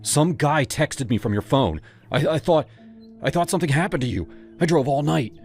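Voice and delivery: tumbling out in worry; a touch of frightened babble; panicked explanation. Acted.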